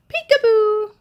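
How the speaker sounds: high, loud playful shout; yelled peekaboo. Acted. Millie jumping out from behind the tree, yelling. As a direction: jump out and yell it. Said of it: "She jumped from behind the tree and yelled".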